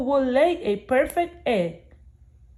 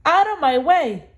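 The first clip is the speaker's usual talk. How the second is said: pushy shout; 6 semitones higher, 4 dB louder than usual. Acted. Goose pushes off the others.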